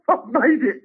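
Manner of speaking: laughing high I've made it; giddy again. Retold or imitated. Comic repeating his thrilled reaction with a laugh. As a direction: gush through a laugh.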